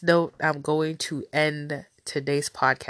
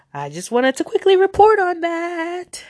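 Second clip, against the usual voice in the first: nasal voice; 13 semitones higher, 5 dB louder than usual. Real, not acted. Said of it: "my nasally self".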